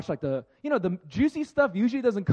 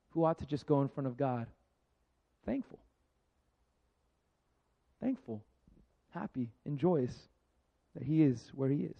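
ordinary talk

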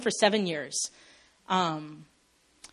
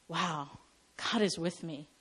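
awed hush; 4 semitones lower, 10 dB quieter than usual. Retold or imitated. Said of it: "our first response isn't".

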